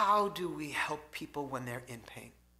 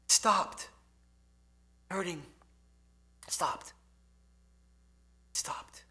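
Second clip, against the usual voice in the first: fading to a whisper; slower than usual. Retold or imitated. Dying woman, words fading away.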